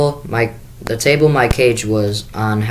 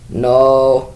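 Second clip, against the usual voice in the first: crying; 3 semitones higher, 3 dB louder than usual. Acted. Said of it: "Sophie cried".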